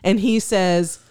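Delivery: ordinary talk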